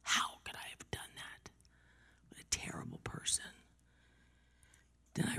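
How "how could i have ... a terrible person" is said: hushed, near-whispered retold shame; horrified self-reproach. Mother blaming herself for leaving her son, hushed horror. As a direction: murmur it to yourself, appalled. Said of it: "I was horrified".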